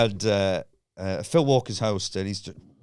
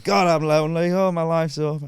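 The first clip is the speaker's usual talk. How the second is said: high, wailing self-pity; comic cry of despair; mock despair; 6 semitones higher than usual. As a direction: lament your lot to the room. Retells despairing at the microphone.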